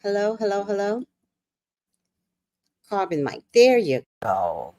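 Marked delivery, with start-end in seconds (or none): none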